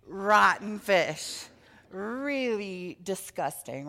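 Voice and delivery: loud, slow, disgusted drawl on "Rotten fish". Acted. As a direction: make the kids smell it: "Rotten fish". Children imagine the fish's belly.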